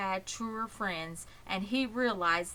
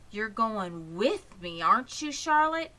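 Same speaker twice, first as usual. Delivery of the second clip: put-on young pig Wilbur, hopeful; 3 semitones higher, 5 dB louder than usual. Wilbur asking Charlotte to come to the fair, eager and hopeful. Wilbur voiced in read story.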